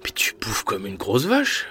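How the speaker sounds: hushed whispered insult; whispering. Acted. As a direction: whisper it behind her back. Brother insulting sister behind her back.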